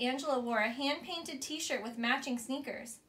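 ordinary delivery, nothing marked

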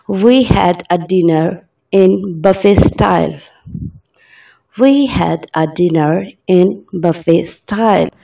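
very slow, word by word dictation; slow example sentence. Shown as a demonstration. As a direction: read it out slowly for learners. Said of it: "example sentence".

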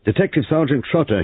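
high, loud cheerful self-introduction; slightly common, cheerful voice. A narrator's reading. New sergeant announcing himself to the household, cheerful. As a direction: announce yourself brightly in a common voice. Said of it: "slightly common but cheerful voice".

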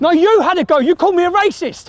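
indignant and high-pitched; angry retort. Real, not acted. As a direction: argue back hotly. Heated argument after being accused.

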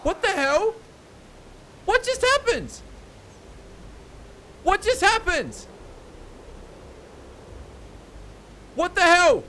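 can't believe what just happened; stunned disbelief. Real, not acted. Dies suddenly in game.

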